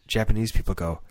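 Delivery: ordinary talk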